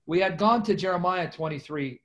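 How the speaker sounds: usual speaking voice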